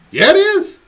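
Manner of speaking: put-on high, loud childish insistence; insistent kid retort. Daughter arguing with dad in the car, shrill loud insistence. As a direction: fire back like a stubborn kid.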